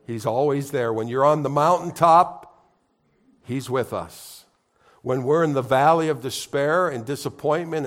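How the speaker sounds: preaching. Acted. Pastor proclaiming Jesus is present to congregation, fervent preaching. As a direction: build the sermon to a ringing peak.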